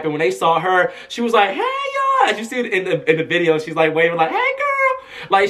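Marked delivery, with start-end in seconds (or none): 1.5-2.3 very high, called-out greeting; hyped. Retold or imitated. Singer greets the crowd, imitated hyped call. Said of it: "her energy just got everybody hyped". 4.3-5.1 squealed high-pitched greeting; happy, hyped. Retold or imitated. Singer waves hello on the carpet, imitated happy hyped greeting. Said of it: "her energy was just so happy".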